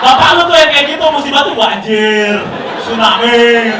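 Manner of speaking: half-laughing outburst; mock rant. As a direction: correct him loudly, drawing out musibah. Ranting at friend over disaster excuse.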